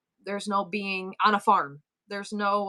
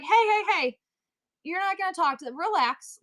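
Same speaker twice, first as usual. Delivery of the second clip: raised, higher retold "hey, hey, hey"; calling out to intervene; 7 semitones higher than usual. Bystander breaking up bar yelling, raised protest. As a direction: step in sharply, pitched up.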